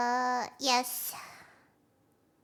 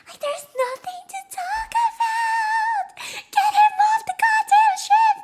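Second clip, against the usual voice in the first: very high screamed outrage, building louder; exasperated screaming; 6 dB louder than usual. Real, not acted. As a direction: yell it at the top of your voice. Outraged outburst at Curly's reply.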